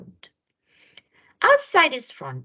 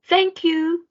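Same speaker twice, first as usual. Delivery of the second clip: high, bright little fox voice; a happy animal thanking him; grateful fox; 12 semitones higher, 4 dB louder than usual. A narrator's reading. The fox thanks George for the sock, high and bright.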